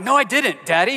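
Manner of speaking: put-on high, tearful denial of a small boy; emotional little boy. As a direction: plead like a toddler caught out. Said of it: "starts getting emotional about it".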